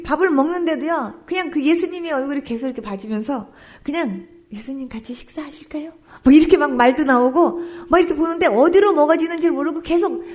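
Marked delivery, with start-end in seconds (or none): 4.5-5.9 soft unvoiced whisper, shy; whispered invitation. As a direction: invite him in a hushed whisper.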